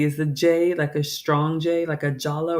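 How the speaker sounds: Jolla with a strong J sound; hard J. Shown as a demonstration. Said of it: "how to pronounce it".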